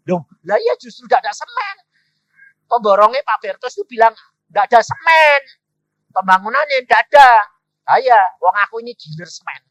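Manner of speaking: loud, high, emphatic retold dialogue; full-force preaching. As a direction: play the cement dealer's excited explanation at volume. Menirukan dialog dengan suara penuh. (berkhotbah lantang, menirukan penjual semen bersemangat)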